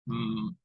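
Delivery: "Mm" hummed low and soft through the nose; nasal M sound. Shown as a demonstration. Teacher demonstrating nasal sounds to learners, soft hummed M. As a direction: hold a nasal "Mm". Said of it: "It has produced the sounds".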